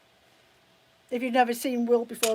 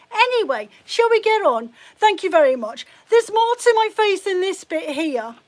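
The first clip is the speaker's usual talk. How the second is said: higher, louder, brisk defiance; indignant brush-off; 6 semitones higher, 6 dB louder than usual. Real, not acted. Streamer moves on from insults about her face, indignant. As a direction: shut the subject down indignantly.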